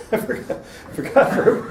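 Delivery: breaking into laughter over the punchline; real laughter cutting into the repeat; laughing repeat. Real, not acted. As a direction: chuckle through the words.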